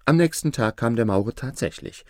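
ordinary delivery

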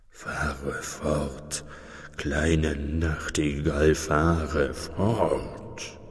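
slow low murmur of Death listening. Acted. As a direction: speak as Death, calm and spellbound. Death speaks to the nightingale.

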